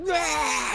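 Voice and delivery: high short pained scream. Acted. Game master voicing the burning trooper, a high scream. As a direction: cry out as he lands in the fire. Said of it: "and he's like".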